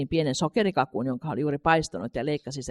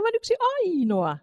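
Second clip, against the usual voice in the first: Katri thinking; slower than usual. A narrator's reading. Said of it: "hän ajatteli".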